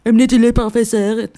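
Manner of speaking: sarcastic mimicry of the president; mocking her. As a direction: parody the official's haughty tone. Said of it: "she's like".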